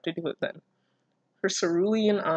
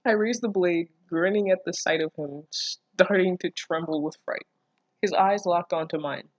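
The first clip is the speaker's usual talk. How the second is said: normal talking voice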